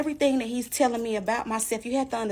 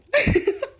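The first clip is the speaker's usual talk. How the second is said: high burst of laughter; 5 dB louder than usual. Real, not acted. Laughs after teasing next story.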